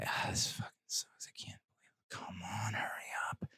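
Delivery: whispered grumble; fed-up whisper. Retold or imitated. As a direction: mutter it under your breath, fed up. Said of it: "had that expression".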